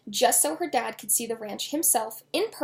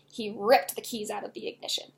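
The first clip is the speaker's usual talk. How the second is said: usual speaking voice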